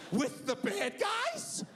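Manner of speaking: high, drawn-out disbelieving question; slow squeal of a kid's horror; horrified child. Retold or imitated. Son's horrified question, retold.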